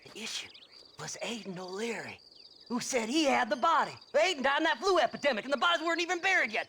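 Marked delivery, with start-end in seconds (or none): 4.1-6.7 rushed explanation. Acted.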